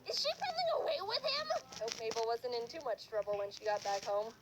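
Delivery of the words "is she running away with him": very high, panicked disbelief; hammed-up high alarm; alarmed shriek. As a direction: squeal it as an alarmed lady. Lady alarmed at Mabel fleeing.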